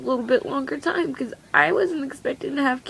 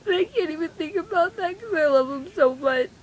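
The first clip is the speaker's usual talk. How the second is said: crying; 3 semitones higher than usual. Real, not acted. Tearful teen mom telling viewers he was taken away, crying. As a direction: voice climbing through real tears. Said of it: "I'm sorry I'm crying".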